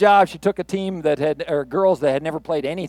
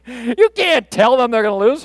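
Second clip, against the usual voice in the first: raised, insistent objection; protesting assistant coach; 5 semitones higher, 4 dB louder than usual. Retold or imitated. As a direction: object in disbelief, higher than usual. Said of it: "assistant coach was like".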